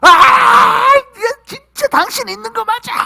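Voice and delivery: very high, loud anguished cry; acted screaming at God. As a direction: wail and shout in outraged despair. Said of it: "막 소리치고".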